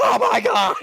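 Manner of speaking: high shrieking panic; mock scream. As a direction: shriek it in fake terror. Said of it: "but it's not gonna be like".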